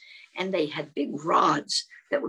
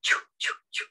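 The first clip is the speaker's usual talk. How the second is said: whispery choo-choo puffs; voiceless, evenly spaced chugs; train sound; much faster than usual. Shown as a demonstration. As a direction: puff like a steam train. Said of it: "make a sound like a train".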